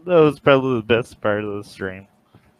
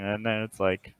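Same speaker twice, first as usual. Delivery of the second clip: ordinary talk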